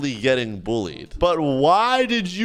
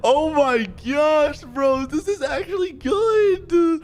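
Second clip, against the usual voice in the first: very high, wailing delight; overjoyed disbelief; 11 semitones higher, slower than usual. Real, not acted. Streamer amazed that someone defends him, overjoyed disbelief. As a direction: squeal it, opening drawn out.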